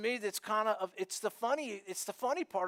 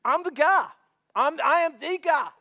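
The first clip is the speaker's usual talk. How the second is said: loud, high proud declaration; healed man proudly telling neighbors it's him; proud claim; 6 semitones higher, 7 dB louder than usual. Retold or imitated. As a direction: announce yourself proudly. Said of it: "he himself says".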